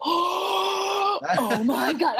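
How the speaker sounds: long gasp, then high, drawn-out amazement; slow, high-pitched awestruck exclamation; re-enacted shocked gasp. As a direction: replay a fan gasping at a big reveal. Re-enacts reaction to the reveal.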